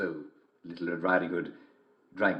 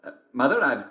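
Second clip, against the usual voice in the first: put-on very high, girlish and bright; little girl voice; 14 semitones higher, 4 dB louder than usual. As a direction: play the small girl at the door, loud and high.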